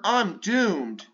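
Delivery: ordinary delivery, nothing marked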